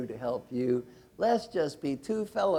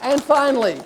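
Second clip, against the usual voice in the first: high, booming prize-giver's call; rally the room into applause; loud cheering; 6 semitones higher, 13 dB louder than usual. Real, not acted. Coach hands over a prize and thanks helpers, loud excited cheering.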